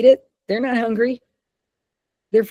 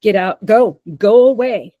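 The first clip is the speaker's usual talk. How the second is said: loud, raised shout to clear someone off; yelling at a stranger; 7 dB louder than usual. Shown as a demonstration. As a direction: bark it out to make a person move along now. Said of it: "I give you permission to yell at them".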